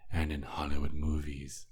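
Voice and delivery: ordinary talk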